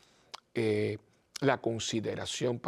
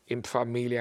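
usual speaking voice

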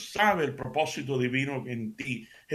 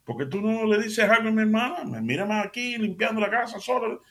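higher-pitched, indignant complaint from Marta; angry complaint; 8 semitones higher than usual. Acted. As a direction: protest at Jesus, offended and raised. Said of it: "Marta se enoja y le dice".

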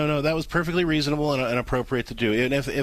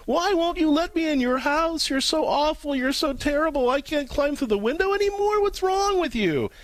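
high, slow, whining mock wail; hammed-up whiny complaint from the backyard; whiny screaming intruder; 14 semitones higher than usual. Locked-out person whining at the back door, high mock wail. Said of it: "still stand in the backyard screaming".

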